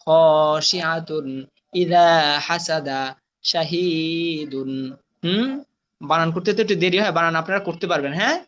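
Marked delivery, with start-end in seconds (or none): 0.0-4.7 slow reading with stretched long vowels; reading aloud. Shown as a demonstration. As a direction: model each word, holding the madd. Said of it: "আমি reading ডে বলে দিচ্ছি". (টান দিয়ে ধীরে reading পড়া)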